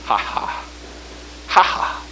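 breathy scoffing ha-ha. Shown as a demonstration. Scoffing at problems, unvoiced.

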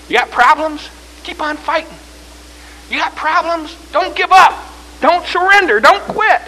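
high-pitched preaching, growing loud at the end; rallying sermon call; mounting exhortation. Acted. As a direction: challenge the congregation, building to a cry.

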